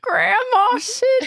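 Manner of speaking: high, slow, delighted call; delighted high squeal. Real, not acted. Delighted at costume.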